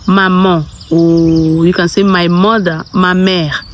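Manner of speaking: normal talking voice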